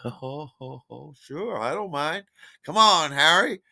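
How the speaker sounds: high put-on voice, building to a loud invitation; Michael's eager agreement. Michael agrees to bring Harry along, eager agreement. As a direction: imitate a friend cooing then calling cheerily.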